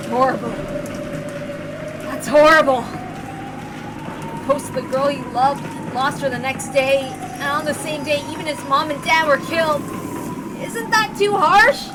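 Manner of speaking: a lightly voiced upset Battler; grieving for his cousin; upset young man.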